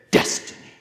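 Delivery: DESTINY as a forceful, voiceless stage whisper; hammed-up mystical emphasis; mock-charismatic "destiny". Shown as a demonstration. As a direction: breathe "destiny" out hard, full of import. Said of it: "you have to say it like".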